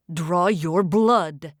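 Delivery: slow, low barked command; barked order. Acted. Isko barks at Bay, slow and low. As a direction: bark the order at him. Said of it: "Isko barked at Bay".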